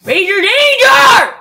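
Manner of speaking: high, drawn-out shout; shouted game call; loud call-out. Real, not acted. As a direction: belt it out slowly, high and loud. Calls out during game.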